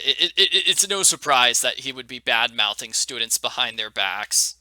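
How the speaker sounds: ordinary talk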